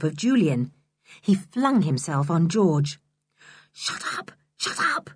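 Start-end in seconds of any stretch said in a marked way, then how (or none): 3.7-5.1 hushed, urgent, the first Shut up breathed; urgent whisper. Acted. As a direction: hiss it to the excited dog. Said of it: "said George in an urgent whisper".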